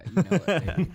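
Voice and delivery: cheesy radio host. Pretend. Hamming up his radio voice.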